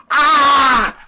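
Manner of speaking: high, loud shriek of rage; furious scream. Acted. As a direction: explode in rage.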